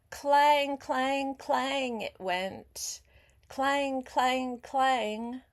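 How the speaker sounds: loud ringing clang, clang; bell clanging. A narrator's reading. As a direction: ring out each clang like a big bell. Bell sound in story.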